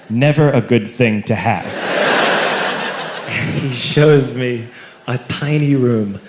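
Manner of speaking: normal talking voice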